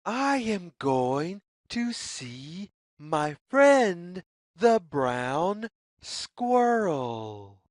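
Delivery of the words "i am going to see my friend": plain reading voice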